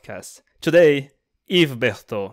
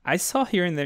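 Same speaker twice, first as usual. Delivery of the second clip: ordinary talk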